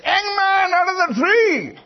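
high, loud shouted summons; piercing acted call to someone far away; preacher calling out. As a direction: imitate a preacher shouting across a field. Preacher calls out someone hidden.

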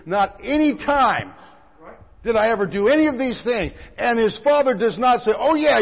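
fervent preacher; preaching at full force. Acted. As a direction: drive the point home to the congregation. Preaching on the elder brother.

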